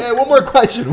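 high, loud mock phone interruption; pretend callback. Host pretending to ring the missionaries back, mock eager shout. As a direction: call out as if catching them before they hang up.

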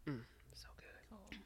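soft savouring whisper. Real, not acted. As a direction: breathe it out, savouring. Savouring the wine.

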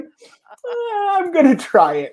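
insist, giggling, that you'll try it; defiant excitement. Retold or imitated. Defying fixer, laughing, voice raised.

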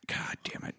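exasperated whisper; voiceless grumble at the situation; whispered curse. Retold or imitated. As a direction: breathe the curse out under your breath. Exasperated man's muttered curse.

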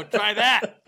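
loud, high laughing dare; laughing taunt. Retold or imitated. Russia taunting Napoleon after burning Moscow, laughing shout. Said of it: "They go".

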